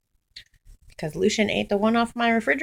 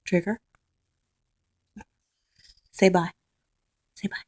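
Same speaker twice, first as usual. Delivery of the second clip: ordinary talk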